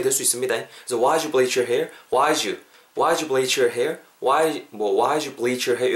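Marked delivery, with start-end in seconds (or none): 1.0-4.0 "Why did you" reduced to "why-joo"; "Why did you" as a fast "why-joo"; did dropped. Shown as a demonstration. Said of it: "극단적으로 더 빨리 발음될 때는". 5.0-5.9 "Why did you" reduced to "why-joo"; "Why did you" as a fast "why-joo"; did dropped. Shown as a demonstration. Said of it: "이렇게 발음이 되었을 때".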